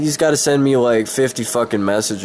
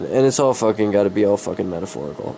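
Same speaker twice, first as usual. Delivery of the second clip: ordinary talk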